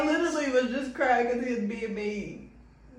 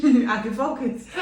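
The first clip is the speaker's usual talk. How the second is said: laughing through the words; can't stop laughing as she says it; 6 semitones higher, 6 dB louder than usual. Real, not acted. Laughing about crying in shower.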